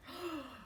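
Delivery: a short mock-offended gasp; shocked gasp. Wolf gasping at the pig's rudeness, short gasp. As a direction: gasp in feigned outrage.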